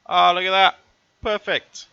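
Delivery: high, loud burst of glee; thrilled it worked out; excited delight. Real, not acted. Manoeuvre lands perfectly.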